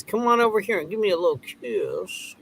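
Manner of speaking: high put-on girly voice; mock teasing girl. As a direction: tease in a high, lovey-dovey imitation. Voices girl teasing about kiss.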